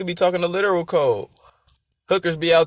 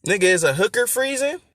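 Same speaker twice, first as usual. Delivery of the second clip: incredulous comeback; 6 semitones higher than usual. Acted. Acts the rapper's incredulous comeback.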